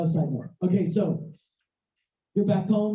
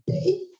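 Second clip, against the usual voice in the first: high imitated phone ping; high-pitched vocal chime; phone notification beep; 13 semitones higher than usual. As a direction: sing out a little alert beep.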